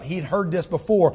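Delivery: usual speaking voice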